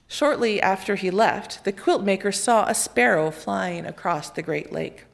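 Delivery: plain reading voice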